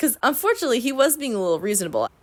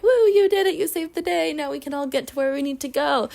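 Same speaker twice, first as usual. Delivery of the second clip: crowd cheer; 6 semitones higher than usual. Retold or imitated. Crowd cheering Adam's car push.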